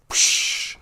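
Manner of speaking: high, loud gasping breath after surfacing; gasp for air. Acted. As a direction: burst out of the water with a ragged gasp. Loon surfaces after failed dive.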